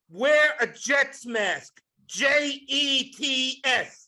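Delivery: slow mock-serious instruction; slow deliberate spelling. Wife's advice to a worried husband, spelled out slowly.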